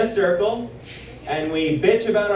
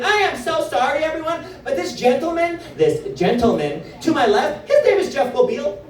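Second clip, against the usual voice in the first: indignant lady announcing; 6 semitones higher than usual. Retold or imitated. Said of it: "She's like".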